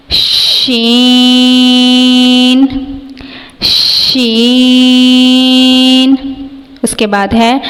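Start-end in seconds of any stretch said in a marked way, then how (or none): 0.1-6.1 شین held long; drawn-out letter demo. Shown as a demonstration. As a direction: stretch شین slowly. Teacher demonstrates letters in turn. (شین لمبا کھینچ کر)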